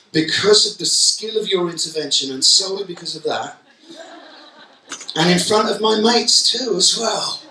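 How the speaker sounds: high, mock-grovelling apology dripping with sarcasm; sarcastic pupil. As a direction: overdo the sorry to mock the teacher. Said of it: "what he could do is go".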